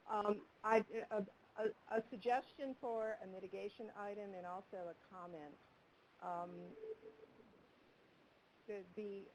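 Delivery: faint, barely audible voice; speaking far too softly; very light voice. Real, not acted. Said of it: "you sound very light to me".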